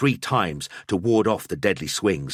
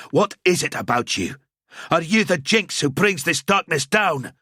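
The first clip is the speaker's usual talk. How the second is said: accusing; 8 semitones higher, 3 dB louder than usual. Acted. Gruber accusing the wife.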